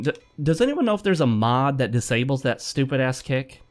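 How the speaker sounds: ordinary talk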